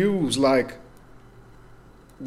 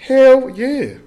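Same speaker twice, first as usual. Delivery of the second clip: excited; 10 semitones higher, 6 dB louder than usual. Real, not acted. Hyped agreement on betting over.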